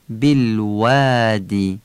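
بالوادی with w from the lips, never v; slow بالوادی, rounded w and long aa; Arabic waw, long alef. Shown as a demonstration. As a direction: draw out the aa in بالوادی. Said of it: "یعنی واو با دندون نگیم".

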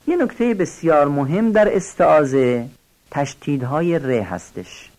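normal talking voice

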